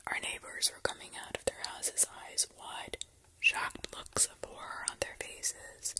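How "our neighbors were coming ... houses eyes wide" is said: plain reading voice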